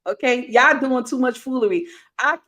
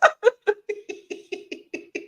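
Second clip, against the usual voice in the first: high wheezing cackle; long, helpless high-pitched laugh; real breathless hysterics; laughing fit; 11 semitones higher, 5 dB quieter than usual. Real, not acted.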